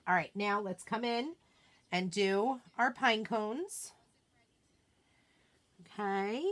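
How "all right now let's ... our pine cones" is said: ordinary talk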